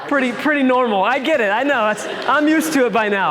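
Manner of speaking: amused, good-natured protest; laughing. Real, not acted. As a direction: laugh off a teasing remark. Joking about her husband Calvin.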